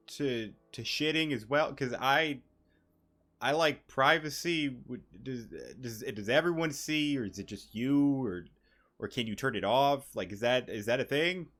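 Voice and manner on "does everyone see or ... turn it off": ordinary talk, nothing marked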